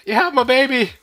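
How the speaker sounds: high, loud squeaky pubescent voice; voice cracking. Acted. Gamer handing his teammate the flag, in a loud, high, cracking boy's voice. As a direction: crack up high like a boy whose voice breaks. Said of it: "that was a fake voice crack".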